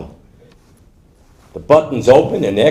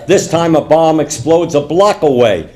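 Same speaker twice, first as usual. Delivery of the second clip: ordinary talk, nothing marked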